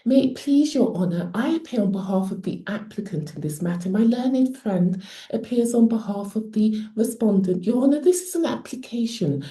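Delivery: formal, quick-flowing posh barrister voice; put-on posh accent. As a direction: address the judge in an affected posh accent. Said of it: "I initially adopted an accent that".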